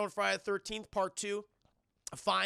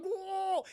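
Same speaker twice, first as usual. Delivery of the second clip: high-pitched drawn-out "whoa"; mock cry of shock; 11 semitones higher than usual. Victim with soap forced into her mouth, mock cry. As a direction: imitate a victim's high wail.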